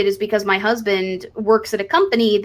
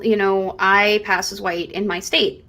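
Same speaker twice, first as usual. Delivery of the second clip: normal talking voice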